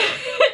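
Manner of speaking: quick bright giggle; a little burst of laughter; short laugh. Real, not acted. Laughs at own joke.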